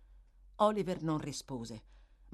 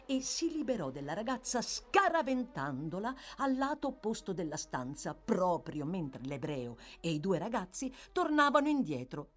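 ordinary delivery, nothing marked

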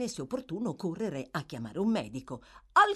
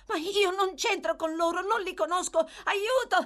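put-on high, pleading boy's shout; frightened cry; 13 semitones higher, 4 dB louder than usual. A narrator's reading. Oliver struggles in the man's grip, crying for help. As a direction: cry out for rescue. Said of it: "gridò Oliver".

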